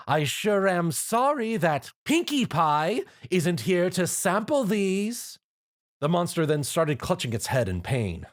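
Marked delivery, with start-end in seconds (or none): 0.1-5.4 high, slow, staged hint; stagy line meant to be overheard; odd intonation. A narrator's reading. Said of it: "with an odd intonation".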